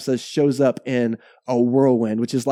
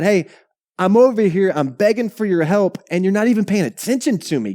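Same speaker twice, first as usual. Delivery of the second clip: high, insistent appeal to God; acted-out frustrated complaint, pitched high; exasperated plea; 7 semitones higher, 4 dB louder than usual. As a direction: plead as if fed up with being ignored. Voicing Job's fed-up plea to God.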